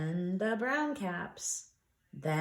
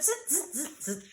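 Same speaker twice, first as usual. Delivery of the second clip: monkey chatter; 7 semitones higher, 4 dB quieter than usual. A narrator's reading. Monkeys mocking the peddler.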